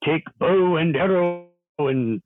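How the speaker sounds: hammy olde English faire hawker, slowed down; fakey Renaissance accent. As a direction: call it out like a mock Elizabethan hawker. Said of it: "pretty good at doing, uh, fakey accents".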